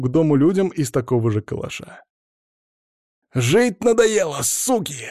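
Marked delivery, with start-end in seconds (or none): 3.3-5.1 slow, high furious yell; shouting. A narrator's reading. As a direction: roar it while firing. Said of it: "заорал он".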